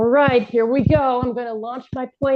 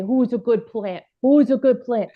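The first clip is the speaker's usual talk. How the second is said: put-on baby talk to a plant. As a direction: coo like to a pet. Cooing at a plant.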